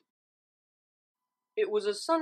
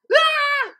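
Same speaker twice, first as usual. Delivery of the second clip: high, loud startled yelp; 11 dB louder than usual. Acted. Twilight startled by Pinkie, yelping high and loud. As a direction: jump at the fright. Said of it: "Twilight yelped".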